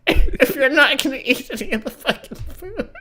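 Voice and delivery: high, loud words through laughter; helpless laughing rant; laughing hard. Real, not acted. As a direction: crack up while finishing the joke. Cracking up at own joke.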